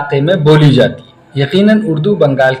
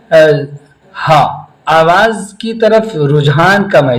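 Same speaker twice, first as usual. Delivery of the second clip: usual speaking voice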